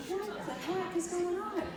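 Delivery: high, drawn-out mock disbelief; retold shock. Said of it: "I mean, I was shocked".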